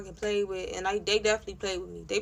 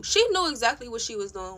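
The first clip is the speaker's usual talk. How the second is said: ordinary delivery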